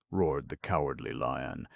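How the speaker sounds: ordinary delivery, nothing marked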